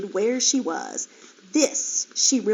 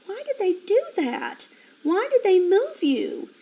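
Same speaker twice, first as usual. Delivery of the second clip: wavering; 10 semitones higher than usual. Acted. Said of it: "her voice wavering".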